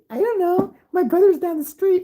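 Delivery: put-on high innocent child voice; a small boy answering a stranger; little kid answering.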